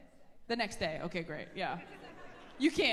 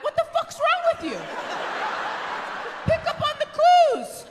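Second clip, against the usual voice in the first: high, loud mock outrage; mock exasperated yell; 8 dB louder than usual. Comic yelling at the man in the crowd, mock outrage. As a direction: yell it like she can't believe him.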